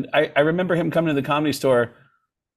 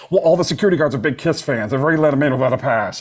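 rushed producer; 3 dB louder, much faster than usual. Retold or imitated. Producer's quick explanation.

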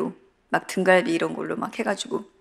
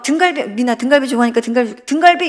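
higher, louder, building to a squeal on the last 등갈비; excited child; 4 semitones higher, 7 dB louder than usual. Retold or imitated. Child cheering for her favorite ribs, excited. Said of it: "이러면서".

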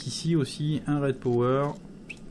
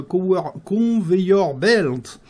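loud, higher, drawn-out attempt at English with a rotten accent; bad English accent; 6 semitones higher, 8 dB louder, much slower than usual. Real, not acted. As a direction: stretch out clumsy English in a bad accent, louder. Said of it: "mon accent anglais pourri".

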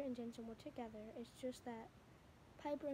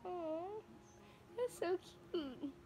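slow, higher aww over a sweet moment; real aww; 6 semitones higher, much slower than usual. Real, not acted. Watching the couple's cute moment, tender aww. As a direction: melt at something cute. Reacting to cute video clip.

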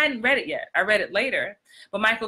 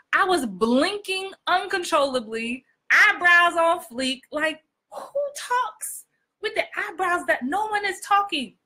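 high, slow and drawn-out; exaggerated on-camera delivery; 8 semitones higher, slower than usual. Shown as a demonstration. Coach showing actors her old camera habits, exaggerated delivery. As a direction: overact with the pitch pushed way up. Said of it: "No one talks like this".

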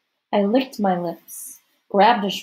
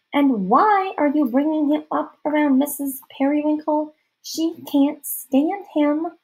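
put-on high, questioning girl's voice; puzzled girlish interrogation; suspicious girl; 7 semitones higher, 3 dB louder than usual. A narrator's reading. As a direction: confront the newcomer, pitched up.

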